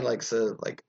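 ordinary talk

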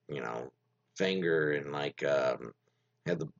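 ordinary talk, nothing marked

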